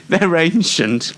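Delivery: ordinary talk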